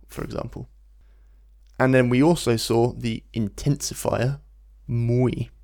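usual speaking voice

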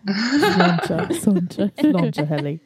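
husky, half-whispered mimicry; deep-voiced toast impression. Host mimicking a cast member's toast, deep husky impression. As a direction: mimic her deep, mumbled toast, breaking into laughs. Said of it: "[name] does a great impression".

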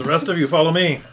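authoritative command. Acted. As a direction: shout orders to the troops. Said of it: "you hear an authoritative voice saying".

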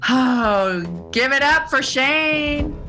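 ordinary talk

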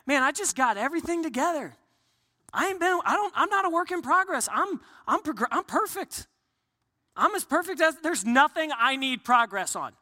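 high, cocky boast of being perfect; mock proud know-it-all. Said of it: "no one would say".

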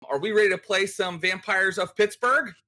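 high, rousing call to play; excited. Real, not acted. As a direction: pump up the table. Host rallies players to start.

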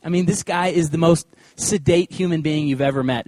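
ordinary talk

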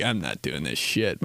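low, gruff mock dad; grumpy dad. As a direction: grumble it like a fed-up father. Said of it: "my dad would be like".

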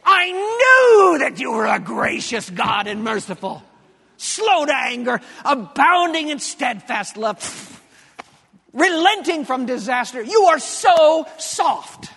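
crying shout, slow and resentful at the end; bitter, tearful rant at God; whiny angry outburst. Shown as a demonstration. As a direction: spit the praise back at God as an accusation. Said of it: "it would have sounded something like this".

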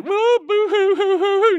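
high wailing boo-hoo sobs; mock boo-hoo wailing. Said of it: "not that kind of crier".

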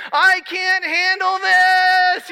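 high, slow, loud imitated child's scream; retold scream. Son screaming to dad on the roller coaster, a high loud wail. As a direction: wail it out like a terrified kid on a ride. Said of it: "and he screams".

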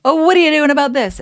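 high, loud, fast mock of the doctor. Mimicking the doctor's puzzled reply, high and loud. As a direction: parrot the doctor's question back, hammed up. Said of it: "I'll never forget how he was like".

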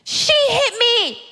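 put-on high, drawn-out child's complaint; tattling child. Child telling mother on her sibling, high tattling complaint. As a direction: play a wronged kid, high and slow.

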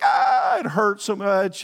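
hammed-up cry of hurt, loud and high; overdone pained yelp; mock wail of pain. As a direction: whine like a walking bruise touched. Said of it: "it's like".

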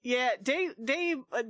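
usual speaking voice

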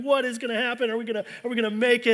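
wife fretting in the snowstorm, mock panic; imitating panicking wife. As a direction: play the wife's fretting in a rush. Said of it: "Stephanie is panicking and freaking out".

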